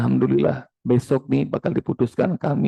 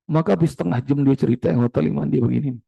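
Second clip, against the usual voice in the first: ordinary delivery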